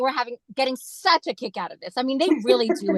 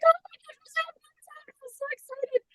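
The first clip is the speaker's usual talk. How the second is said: much higher, fading excited gush; thrilled squeal; 6 dB quieter than usual. Retold or imitated. Fan on stage beside the singers, squealing with excitement. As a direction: squeal with giddy joy. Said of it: "and I'm like".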